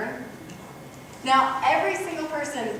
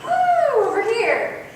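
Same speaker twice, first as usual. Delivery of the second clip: mock child squealing much higher and louder; playful kid; 9 semitones higher, 5 dB louder, slower than usual. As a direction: play an excited little boy calling out. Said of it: "and so he's like".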